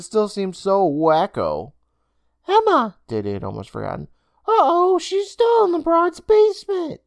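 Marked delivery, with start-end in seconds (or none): 2.5-3.0 put-on high boyish voice, struck by memory; sudden realization. Boy suddenly remembers sister. 4.5-7.0 put-on high, louder boyish voice, alarmed; worried boy. Boy realizes sister trapped.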